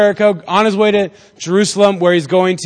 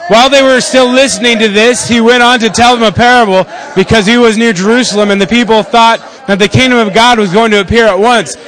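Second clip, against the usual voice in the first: loud, raised-pitch group reading; as loud as possible; 5 semitones higher, 9 dB louder than usual. Real, not acted. Preacher leading everyone reading verse eleven together, shouting. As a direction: belt out the scripture reading at full volume. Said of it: "as loud as you can".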